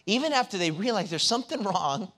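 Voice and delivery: high, loud preaching at full force; preacher urging the young people in church, at full force. Acted.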